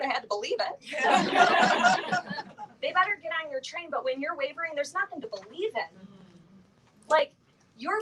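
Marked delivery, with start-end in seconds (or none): 0.0-2.6 high, slow, laughing through it; giggling while quoting her. Retold or imitated. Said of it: "And she said".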